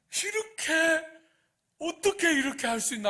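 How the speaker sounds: high, loud aggrieved protest; indignant outcry. Retold or imitated. Grumbling worker protesting to the vineyard owner, loud and indignant. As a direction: cry out like a wronged worker cheated of his due. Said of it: "하면서 막".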